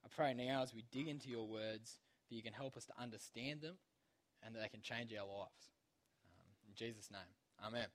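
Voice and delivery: soft, hushed prayer voice. Acted. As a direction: pray quietly and reverently. Closing prayer before reading.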